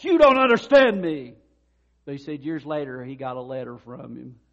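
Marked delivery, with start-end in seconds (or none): 0.0-1.3 loud, high, indignant protest; angry outburst. Retold or imitated. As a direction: throw it back at him, hurt and furious. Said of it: "the guy stormed out of his office".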